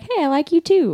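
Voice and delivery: bubbly cutesy reading of "I like U two". Retold or imitated. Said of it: "I thought it was just something cutesy".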